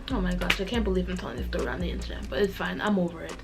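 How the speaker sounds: ordinary delivery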